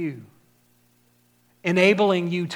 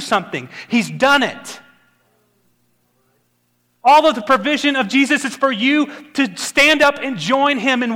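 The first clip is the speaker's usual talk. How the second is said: louder, higher urgent preaching; preaching at full force; 5 semitones higher, 4 dB louder than usual. Acted. Pastor urging the congregation to step out in faith, full preaching force. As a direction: drive it home to the church.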